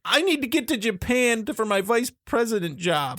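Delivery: high whiny complaining voice; man flu. Retold or imitated. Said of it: "man flu".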